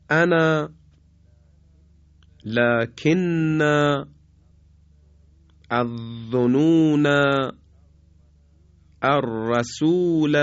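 each word intoned slowly and clearly, with long pauses; slow recitation. Shown as a demonstration. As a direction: recite the Quranic words one by one, slowly. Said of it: "دقت بفرمایید".

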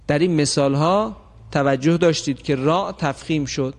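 normal talking voice

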